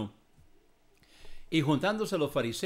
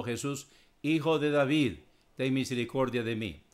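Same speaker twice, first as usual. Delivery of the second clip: ordinary talk, nothing marked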